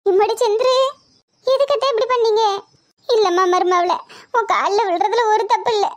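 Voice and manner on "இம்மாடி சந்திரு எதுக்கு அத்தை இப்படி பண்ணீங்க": alarmed protest. Acted. Daughter-in-law begs her mother-in-law to rise, high and alarmed. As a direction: stop her, voice leaping up in alarm.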